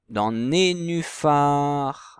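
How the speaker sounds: nénuphar drawn out syllable by syllable; very slow syllabified demo. Shown as a demonstration. Teacher to pupils, sounding out the word very slowly. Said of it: "la bonne syllabe".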